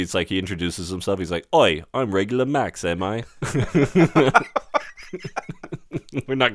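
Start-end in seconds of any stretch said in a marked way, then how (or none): 1.5-3.2 a clumsy mock Australian Max; a poor imitation of an Australian tough guy; bad Australian accent. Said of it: "I can't do an Australian accent". 3.3-4.9 bursts out laughing at herself; helpless giggle after the joke; laughing at her own bad accent; real laugh. Real, not acted.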